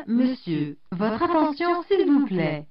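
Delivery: ordinary talk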